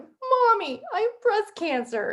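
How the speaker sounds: high, drawn-out mock wail; imitated crying. Daughter breaking news to her mother, imitated crying. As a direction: retell it in a high, slow, tearful voice. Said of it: "I start crying and I'm like".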